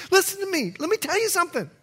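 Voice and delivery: high-pitched Bahamian accent. Real, not acted. Preacher demands the church listen, Bahamian accent. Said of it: "my accent coming out as soon as I start talking".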